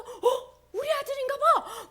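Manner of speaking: high, loud, joyful gasp of recognition; a father who can't believe his eyes; father spotting his son far off, thrilled; excited father. Acted. Father spots son afar.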